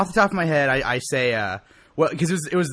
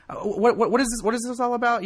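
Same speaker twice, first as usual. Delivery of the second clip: retold baffled question, pitched up; 8 semitones higher than usual. A driver asks the cop, in mild retold bafflement. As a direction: play the confused driver asking the cop what's going on. Retelling his reply to the cop.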